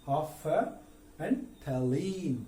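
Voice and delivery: half and thalene drawn out very slowly; slow syllable breakdown; syllable split. Shown as a demonstration. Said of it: "इसको पढ़ेंगे कैसे".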